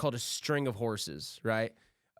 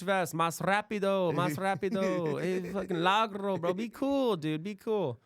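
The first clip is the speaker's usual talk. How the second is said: mock coworker; 7 semitones higher than usual. Mocking coworkers on job site.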